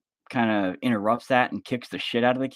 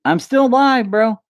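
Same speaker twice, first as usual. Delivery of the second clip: surprised loud outburst; 14 semitones higher, 11 dB louder, slower than usual. Real, not acted. Sudden outburst about being live.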